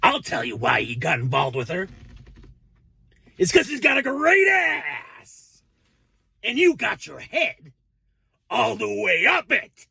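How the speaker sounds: over-the-top bad cop, voice jumping high; hammy interrogation rant, shouting upward; Al Pacino. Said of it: "let's go ahead with the impersonation".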